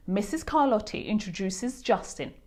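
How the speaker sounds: ordinary delivery, nothing marked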